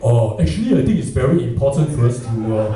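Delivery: corporate Singlish. Retold or imitated. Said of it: "Shenton Way, sounds totally different".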